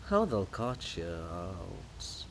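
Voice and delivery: slow laughing taunt; mocking laugh. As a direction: laugh at his fate.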